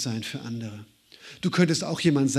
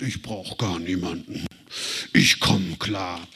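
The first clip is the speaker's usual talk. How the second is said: low, slow, stubborn man's voice; mock a proud man who needs nobody; gruff tough-guy quote; 10 semitones lower than usual. Preacher imitating a man who claims he needs no one, low and stubborn. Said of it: "wo du denkst".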